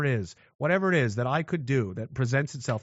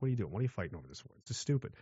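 soft scolding; 7 semitones lower, 11 dB quieter, much faster than usual. Retold or imitated.

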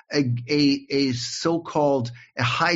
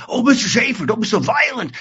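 higher, louder, prissy mock of the warden; 7 semitones higher, 6 dB louder than usual. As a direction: ham up the scolding prison warden. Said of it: "the warden says".

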